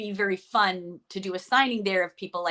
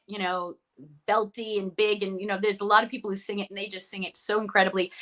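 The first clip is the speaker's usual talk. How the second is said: usual speaking voice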